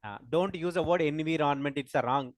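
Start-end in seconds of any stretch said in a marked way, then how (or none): 1.0-1.8 "environment" sounded out as en-vee-RON-ment; mispronounced environment. Shown as a demonstration. Teacher quotes the wrong word to the class, mispronounced environment. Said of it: "It's pronunciation wrong".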